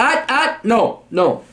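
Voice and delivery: high, sharp cut-off; scolding interruption. Real, not acted. Cuts off the letter writer.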